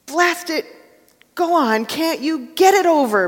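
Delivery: the ghost bellowing at the angel to get it over with. Acted. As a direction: roar it out in desperation. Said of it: "bellowed the ghost".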